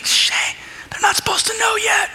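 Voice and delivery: high, urgent stage whisper; mock secretive whisper. Imagined timid Jesus shushing the unclean guy, mock whisper. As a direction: hush him as if keeping a secret. Pretend hushed plea to unclean spirit.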